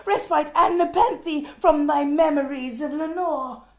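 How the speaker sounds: high and loud, urgent declaiming; crying out. Acted. The mourner cries out to himself of relief from Lenore, loudly. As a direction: hurl the promise of relief upward. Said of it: "I cried".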